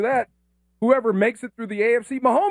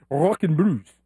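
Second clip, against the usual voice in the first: put-on accent, pitched down; imitating Laura's accent; 4 semitones lower, slower than usual. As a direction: lower mock accent on Rock and Brews. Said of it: "or as Laura says it".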